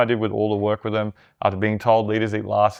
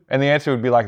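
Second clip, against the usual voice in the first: normal talking voice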